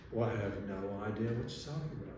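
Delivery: quick, soft mock-British gallery lady; imitated British brush-off, fast and quiet; British accent. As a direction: rattle it off in a put-on British accent. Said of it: "my best British accent because that's what she was doing".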